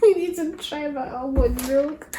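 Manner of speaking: put-on playful child's plea; pleading daughter. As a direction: coax mom like an eager child. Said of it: "she kept on convincing me".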